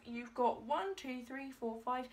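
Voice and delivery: ordinary talk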